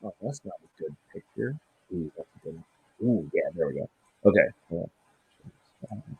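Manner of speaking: muffled, turned away from the mic; speaking away from the microphone. Real, not acted. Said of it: "You sound muffled as shit".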